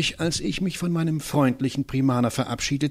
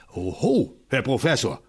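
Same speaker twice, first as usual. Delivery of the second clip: usual speaking voice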